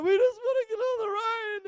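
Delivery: put-on high, teary whine of a frustrated child; mock crying. Daughter whining through tears in the long queue. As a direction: imitate a daughter crying to get on. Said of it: "she started crying".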